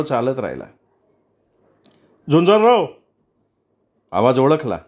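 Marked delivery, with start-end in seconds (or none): 2.3-2.9 calling out. A narrator's reading. Vicky calls from behind.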